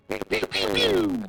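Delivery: high-pitched imitated zapping shots; playful high "pew" noises; pew-pew sound effect. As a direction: voice little blaster shots, high and drawn out. Imitates firing at the Glabrezu.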